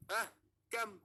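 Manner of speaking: Ah, kam asked high with a clear rise; rising question. Shown as a demonstration. Teacher demonstrating the question tune on Ah, kam. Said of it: "intonasi nada yang menunjukkan bahwasannya itu sebuah pertanyaan".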